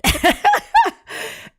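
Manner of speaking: high, loud squealing laugh; burst of laughter. Real, not acted. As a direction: crack up at the memory. Laughing at her own story.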